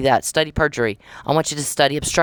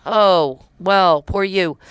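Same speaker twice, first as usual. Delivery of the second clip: higher, drawn-out sarcastic pity; mock sympathy; 5 semitones higher, 3 dB louder, much slower than usual. Scoffing at someone complaining he got caught, mock sympathy. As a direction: pity them with open sarcasm.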